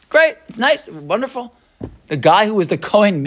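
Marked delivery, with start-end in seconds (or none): 0.1-1.5 mock impressed. Mock praise for an ordinary convert.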